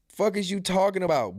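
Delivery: ordinary delivery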